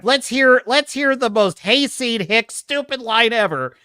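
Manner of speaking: ordinary talk, nothing marked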